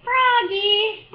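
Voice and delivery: loud, high call of a father. Acted. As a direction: shout his name up the stairs. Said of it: "called his father".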